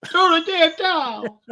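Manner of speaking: high, slow, loud scream. Retold or imitated. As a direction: bellow it like a corner man. Echoes Duke's scream.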